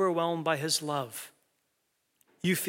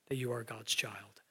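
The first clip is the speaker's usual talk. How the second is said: whispered and lower, like a secret told close; 5 semitones lower, 11 dB quieter than usual. Shown as a demonstration. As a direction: breathe it out softly to one listener. Scripture repeated intimately.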